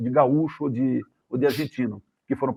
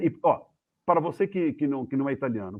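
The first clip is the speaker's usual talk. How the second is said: ordinary talk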